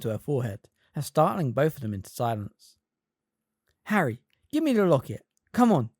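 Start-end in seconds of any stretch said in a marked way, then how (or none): 3.8-5.9 loud, impatient demand. A narrator's reading. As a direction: hurry him along. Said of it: "she said impatiently".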